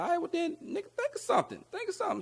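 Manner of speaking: very high, squeaky mock challenge; imitating producer. Producer telling the rapper to write something, high mock voice. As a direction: push him in a cartoonish high voice.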